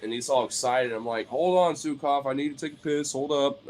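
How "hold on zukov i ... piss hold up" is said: higher-pitched hold-off to an eager dog; retold pleading. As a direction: fend off the excited pup, pitched up. Telling excited dog to wait.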